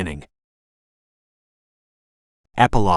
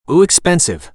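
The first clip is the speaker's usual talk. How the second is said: high, loud teasing ooh; 7 semitones higher, 5 dB louder than usual. Acted. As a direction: tease it, eyebrows up. Sister teasing about pricey dinner.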